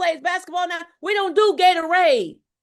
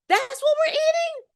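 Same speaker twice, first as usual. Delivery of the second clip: high, gasping disbelief at the food; shocked pearl-clutching; 11 semitones higher than usual. Retold or imitated. Speaker voicing a shocked viewer's reaction, scandalised. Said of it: "the Tanya said she clutching her pearls".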